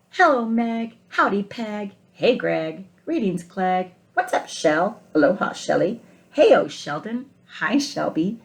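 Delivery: put-on loud, playful greetings read to children; picture-book read-aloud, lively and warm; cheerful story narration. Reading the egg greeting friends.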